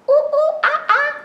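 high loud monkey hoots; monkey chatter. Shown as a demonstration. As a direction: hoot like a monkey. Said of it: "What do monkeys chatter like".

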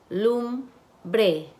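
Lumbre split as "lum... bre" with a pause; syllable by syllable. Shown as a demonstration. Teacher blending syllables for students, syllable by syllable. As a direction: break Lumbre into two separate syllables. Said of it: "van a segmentar sílabas".

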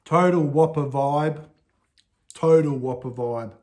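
speaking with food in mouth; chewing between words; talking while chewing. Real, not acted. Seen 0: holds bitten burger, jaw chewing between phrases, mouth partly full.

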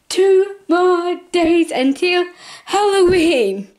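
high, slow, drawn-out excitement; crazy excited. Shown as a demonstration. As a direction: stretch every word like you can't wait. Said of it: "Reason why I'm talking like this, going crazy".

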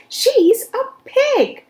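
high, loud, slow indignant shout; angry shout. Acted. Eugenia shouting at Baby about the pig, high and loud. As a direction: shout it out in scorn. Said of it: "shouted Eugenia".

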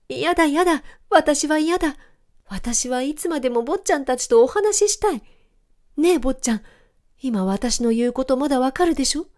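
high, excited dog begging; eager pleading. A narrator's reading. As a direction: beg the children to stay. Dog pleading not to part.

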